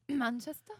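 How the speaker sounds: unsure fake English accent, rising; fake Manchester accent. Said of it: "And I was like".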